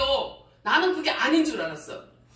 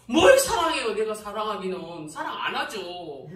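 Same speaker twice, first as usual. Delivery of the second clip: normal talking voice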